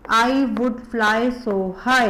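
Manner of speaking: drawn-out slow reading of the lines; very slow recital. Shown as a demonstration. Teacher rereading the poem lines slowly for kids. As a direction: stretch every word slowly. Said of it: "three lines बोलो दोबारा".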